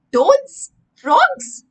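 high, loud horrified questions; shocked guess. Acted. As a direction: fling out each guess in high alarm. Sammy accusing about soup.

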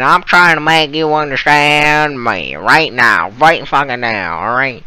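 put-on lampoon the dad with a rough Alabama accent; mock Alabama accent. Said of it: "sounds straight out of Alabama".

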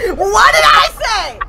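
high, loud outburst of frustration; exasperated yell. Real, not acted. Player blown up after friend's jinx, exasperated high yell. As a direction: cry out high at the friend who jinxed it.

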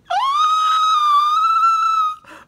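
loud, very high gasp of fright; shocked gasp. Retold or imitated. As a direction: gasp as if a mouse just ran past. Seeing a mouse in the room.

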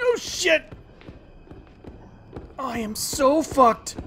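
high loud panicked yell. Real, not acted. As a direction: panic as the monster appears. Monster spotted, panic.

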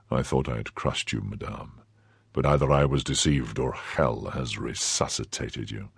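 low, soft, cold nobleman; Athos. Acted. Athos coldly confronts his former wife, low and soft.

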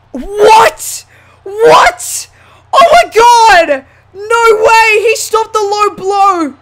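high, loud, incredulous cries; shouted disbelief. Real, not acted. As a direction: yell it, utterly stunned. Disbelief at near fall.

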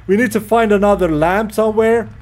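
normal talking voice

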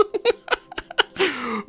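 high giggling laugh; real laugh. Real, not acted. Player spotting own backwards engines, high real laughter.

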